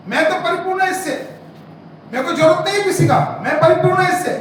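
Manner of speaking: loud, high-pitched pulpit challenge; impassioned, scolding exhortation; forceful preaching. Real, not acted. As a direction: confront the congregation at full voice.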